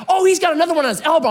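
high, loud and fast alarm; excited alarm. Retold or imitated. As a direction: blurt it out in a rush. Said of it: "my wife is like".

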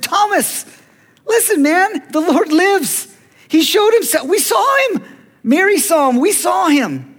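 high, loud, breathless-with-news exclamations; excited news-breaking. Acted. Friends telling Thomas the Lord lives, excited shouting. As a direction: cry it out as an eyewitness desperate to convince.

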